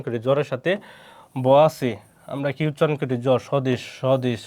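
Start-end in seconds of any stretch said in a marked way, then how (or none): none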